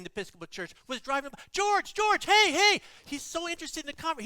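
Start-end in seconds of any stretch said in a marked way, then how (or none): 1.5-2.8 loud, high hail; calling out. Retold or imitated. Stranded preacher hails the passing pastor, loud call. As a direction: yell to catch his attention as he drives past.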